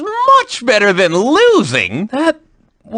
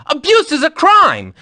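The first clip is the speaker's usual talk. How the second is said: normal talking voice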